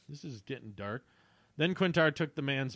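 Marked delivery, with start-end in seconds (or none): 0.1-1.0 quiet aside. Real, not acted.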